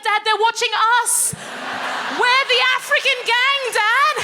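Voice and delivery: high, loud urgent plea; exasperated warning. Retold or imitated. Daughter warning her dad about the neighbourhood watch, high and urgent. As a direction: warn dad with disbelief.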